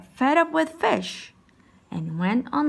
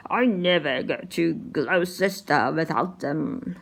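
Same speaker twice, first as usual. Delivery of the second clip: delighted Doctor Foster; 5 semitones lower than usual. Acted. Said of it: "says a delight Doctor Foster".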